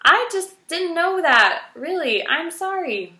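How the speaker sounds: high, loud anxious sorry; frightened apology. A narrator's reading. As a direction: apologize urgently. Poppy apologizing fearfully.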